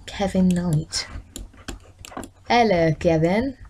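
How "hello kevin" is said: ordinary talk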